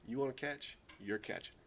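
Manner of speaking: quiet, matter-of-fact ultimatum; understated stern dad, voice dropping at the end; retold firm dad. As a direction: lay down the law softly. Retold dad's firm verdict.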